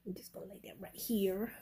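soft whisper near the cat. Real, not acted. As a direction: whisper so he isn't startled. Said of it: "whispering near cat".

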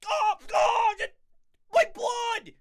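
high, loud mock sobbing wail; hammed-up crying. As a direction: sob it out in over-the-top despair. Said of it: "so he's like".

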